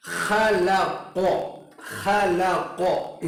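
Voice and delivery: खलक़ very slow, each letter apart; slow demo. Shown as a demonstration. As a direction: stretch खलक़ out slowly, sounding ख and क़ apart. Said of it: "तो ये किस तरह पढ़ा जाएगा".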